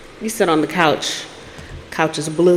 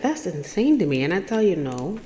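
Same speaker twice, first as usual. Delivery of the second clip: ordinary talk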